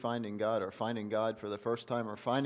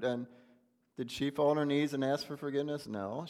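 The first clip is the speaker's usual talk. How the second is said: usual speaking voice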